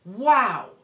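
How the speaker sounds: loud shocked wow, as if slapped; stunned parent after a cruel remark, loud wow. Shown as a demonstration. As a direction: react like you were just hit in the face. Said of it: "look as though you've literally been hit in the face".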